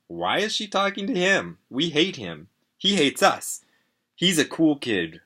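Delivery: high-pitched, emphatic protest; indignant disbelief. A narrator's reading. Narrator seeing a friend with someone he hates, indignant. Upset at friend talking to rival.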